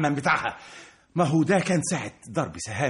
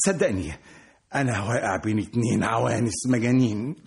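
ordinary talk, nothing marked

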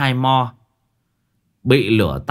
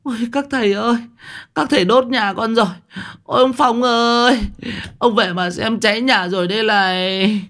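high shrill wail, house burning; wailing old woman; 13 semitones higher than usual. Acted. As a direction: cry out to the monks in despair. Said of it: "Bà Lụa rú lên". (bà Lụa rú lên, giọng cao than khóc)

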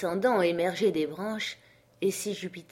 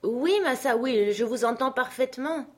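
servant calling back; 6 semitones higher than usual. Acted. Jupiter answers his master from the tree, calling down.

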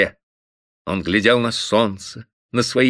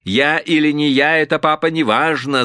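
a hint of babyish lisping; lightly voiced son, sugary lisp; lisping coo; 4 semitones higher than usual. A narrator's reading. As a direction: coo it like a spoiled child. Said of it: "засюсюкал Антоша".

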